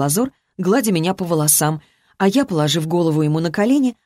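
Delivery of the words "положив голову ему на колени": ordinary delivery, nothing marked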